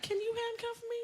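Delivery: high, soft flirty ask; flirty reply. Retold or imitated. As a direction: coo it at the cop. Flirting with police officer.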